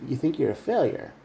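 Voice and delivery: usual speaking voice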